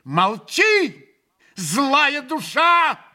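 furious narrated shout at a spiteful man. A narrator's reading. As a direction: hurl it at him. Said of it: "яростно крикнула".

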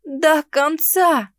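high, drawn-out tearful wail; sobbing. A narrator's reading. Girl feigning heartbreak to sway Henrich, sobbing. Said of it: "Громко всхлипнула".

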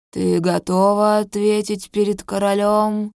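put-on a grave old sage questioning; wise old sage. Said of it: "Раздаётся голос старца". (мудрый старец, медленно и громко)

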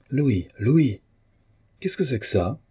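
angry. A narrator's reading. The painter challenging his cousin over the will, angrily. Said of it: "ajouta le peintre avec colère".

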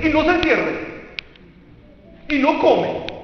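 high, loud scold; mock scolding parent. Imitating parents scolding a sulking child, high and loud. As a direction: nag like an old-school parent. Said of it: "¿Pero qué nos decían?".

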